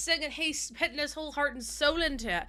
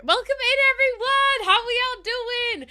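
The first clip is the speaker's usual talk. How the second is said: high, loud bright hello to chat; excited greeting; 7 semitones higher, 7 dB louder than usual. Real, not acted. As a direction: greet the whole chat with a burst of energy. Streamer greets chat again.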